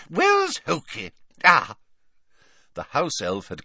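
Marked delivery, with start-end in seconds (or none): 0.1-1.8 put-on old lady voice. A narrator's reading. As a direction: coo it like a doting old woman. Old witch calls for elf.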